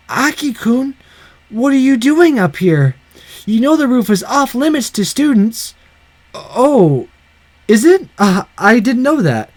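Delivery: put-on bossy girl reprimanding; scolding schoolgirl voice. Megumi scolding.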